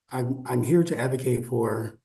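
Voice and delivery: ordinary talk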